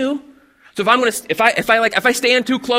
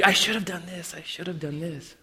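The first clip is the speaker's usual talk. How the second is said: fading mock lament; 3 semitones lower, 10 dB quieter than usual. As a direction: voice dropping away to almost nothing on I should have done this. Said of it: "we, we say".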